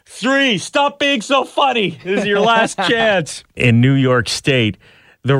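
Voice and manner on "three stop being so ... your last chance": laughing mock countdown.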